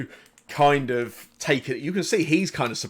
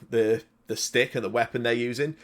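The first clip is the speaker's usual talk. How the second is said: usual speaking voice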